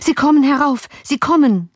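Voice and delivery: high, alarmed calling; frightened servant's cry. A narrator's reading. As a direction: cry out an urgent warning. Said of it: "rief der Diener".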